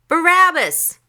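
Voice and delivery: high, loud shouted answer; one loud cry from the crowd. A narrator's reading. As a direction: call it out like a mob. Crowd calls out its choice.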